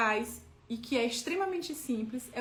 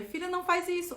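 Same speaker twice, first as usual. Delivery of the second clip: um pouco mais aguda, repreendendo a filha; ordem à filha; 7 semitones higher than usual. Retold or imitated. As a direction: ralhe com a filha num tom mais alto. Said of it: "às vezes eu dizia". (mãe repreende a filha, voz mais aguda)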